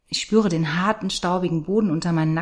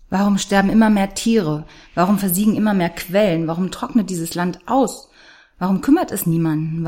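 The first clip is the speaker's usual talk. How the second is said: louder, demanding each why; angry questions; 5 dB louder than usual. Acted. Girl raging at a world that does nothing, loud demanding questions. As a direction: hurl the questions out, accusing.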